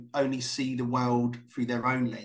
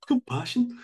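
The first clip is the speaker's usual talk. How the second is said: 1 copying Brummie accent; 11 semitones higher, 4 dB louder than usual. Shown as a demonstration. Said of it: "copying the Brummie take".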